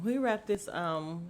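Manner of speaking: usual speaking voice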